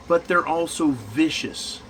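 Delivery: ordinary talk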